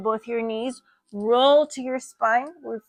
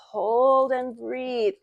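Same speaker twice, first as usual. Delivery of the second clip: slow drawn-out cue; much slower than usual. Shown as a demonstration. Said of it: "yoga teacher cue".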